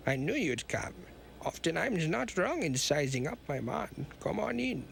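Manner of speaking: hammed-up movie villain German accent. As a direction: play a cartoon German villain. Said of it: "movie villain German accent".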